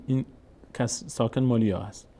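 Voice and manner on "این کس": ordinary delivery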